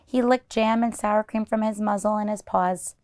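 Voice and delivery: usual speaking voice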